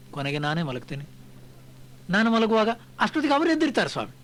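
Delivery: normal talking voice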